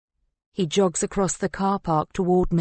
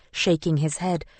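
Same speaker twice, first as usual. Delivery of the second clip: ordinary delivery, nothing marked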